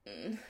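deep breathy moan; low needy moan. A narrator's reading. Chris moaning to Adam on the phone, low and needy. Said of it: "Chris moans, low and needy".

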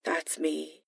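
barely audible, nervous admission. Acted. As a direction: push it out quietly, wary of the reaction. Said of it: "He sounded nervous".

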